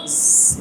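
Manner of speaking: স- as a long voiceless hiss; unvoiced drawn-out স- hiss. Shown as a demonstration. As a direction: breathe out a pure s hiss for স-. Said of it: "এভাবে করে একটা আওয়াজ করতে হবে".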